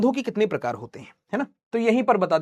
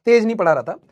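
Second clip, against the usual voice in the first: normal talking voice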